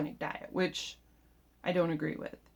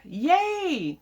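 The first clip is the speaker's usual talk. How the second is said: a high, loud, happy Yay!; shouted yay; 10 semitones higher, 5 dB louder than usual. Shown as a demonstration. Texting sister back after good news, excited shout. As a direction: shout Yay! as if the text were in all caps. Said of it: "That means I'm shouting".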